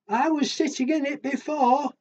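high, small-creature voice; little animal voice. Acted. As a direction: squeak it out like the tiny one. Little animal's turn in the story.

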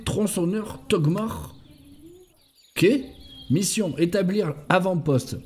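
measured, evenly intoned briefing; military report reading. Acted. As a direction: read out a mission report item by item. Reads in-game mission report aloud.

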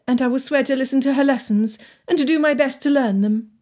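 high-pitched Anne making promises; earnest young Anne. Acted. Anne continues her promises.

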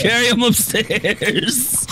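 slow high repeat. Real, not acted. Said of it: "All right, everyone, just carry him upstairs".

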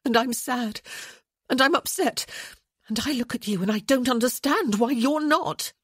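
high, loud, upset outpouring; rising intensity. A narrator's reading. Woman upset that he seems unmoved, rising intensity. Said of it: "her voice rising in intensity".

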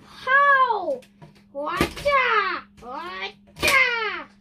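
a toy robot yelling kung fu chops; Grimlock battle cries. Pretend. Kid voicing Grimlock attacking the villains. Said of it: "And then Grimlock said".

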